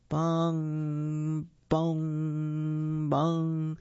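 slow, even, ringing bongs; clock chime. Acted. Clock strikes midnight in story.

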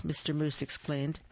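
ordinary delivery, nothing marked

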